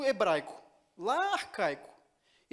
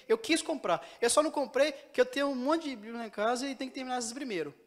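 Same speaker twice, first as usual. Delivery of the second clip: usual speaking voice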